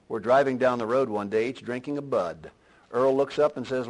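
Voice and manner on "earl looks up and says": ordinary delivery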